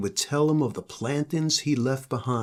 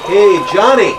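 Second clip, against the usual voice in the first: big hollered call across the yard; loud holler; 6 semitones higher, 15 dB louder than usual. Acted. Pioneer man hollering at Johnny from behind. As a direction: shout his name from behind him. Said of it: "a loud voice hollered".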